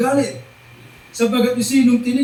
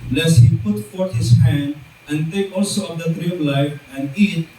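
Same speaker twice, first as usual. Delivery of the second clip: lower, measured Bible reading voice; scripture reading; 5 semitones lower than usual. Acted. Preacher reading Genesis to his congregation, lower and solemn.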